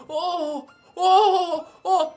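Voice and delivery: high, laughing mock moans; hammed-up high moaning. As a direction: moan it up in a high voice, half laughing. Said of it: "And he's like".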